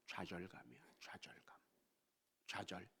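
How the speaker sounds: low voiceless whisper; grave whisper. Acted. Preacher naming despair to the congregation, a grave whisper. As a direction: breathe the word like a secret. Preacher names the worse thing.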